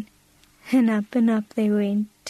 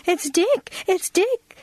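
put-on high-pitched excited little girl; eager, high exclamation without shouting; excited cry; 9 semitones higher, slower than usual. A narrator's reading. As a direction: cry out on spotting a lost pet. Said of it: "cried Minnie".

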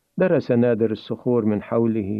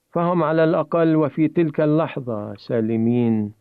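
usual speaking voice

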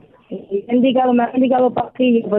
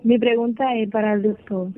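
usual speaking voice

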